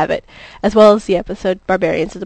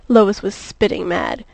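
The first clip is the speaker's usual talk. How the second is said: ordinary delivery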